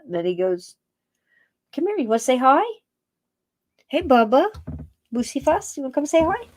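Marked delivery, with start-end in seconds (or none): 1.8-6.5 high, cooing call with rising ends; pet-calling voice. Real, not acted. Coaxing the cat to come say hi, high pet-talk voice. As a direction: coax a pet over in a higher voice.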